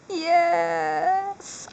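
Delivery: long squealed yes; too excited. Real, not acted. Collector still celebrating the pin she pulled, excited squeal. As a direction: stretch the yes out in real glee. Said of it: "Sorry, I'm too excited".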